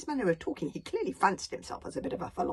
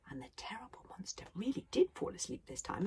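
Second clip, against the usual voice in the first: whispered narration; 4 semitones higher, 6 dB quieter than usual. A narrator's reading. Soft closing narration.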